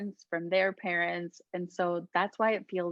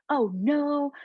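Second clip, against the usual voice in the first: higher, louder mock-dramatic gasp of "Oh no"; 7 semitones higher, 4 dB louder than usual. Shown as a demonstration. Showing listeners a dramatic boundary, higher and louder. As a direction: play up a fake boundary drama.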